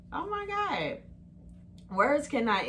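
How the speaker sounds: high overwhelmed "oh my God"; gushing. Real, not acted. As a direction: squeal it with joy. Overwhelmed gush.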